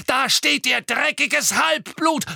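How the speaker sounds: high, loud, drawn-out screech; deranged shriek. A narrator's reading. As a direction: shriek the insult with wild outrage. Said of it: "kreischte Bellatrix zusammenhanglos".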